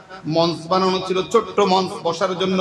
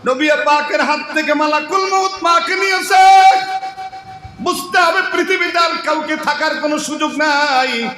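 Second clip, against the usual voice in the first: high, raised sing-song preaching; impassioned preaching; 8 semitones higher, 4 dB louder than usual. Acted. Preacher telling of the Prophet losing his mother, impassioned high chant. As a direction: preach it out high, drawing out the phrase endings.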